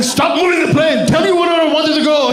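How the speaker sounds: high angry shout at the crew, hammed up; angry dad. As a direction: yell at the plane like a furious dad. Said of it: "really mad".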